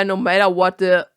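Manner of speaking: usual speaking voice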